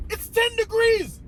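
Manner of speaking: "It's ten degrees" high, incredulous, at normal pace; high disbelieving "It's ten degrees". Retold or imitated. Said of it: "I didn't say all that inside".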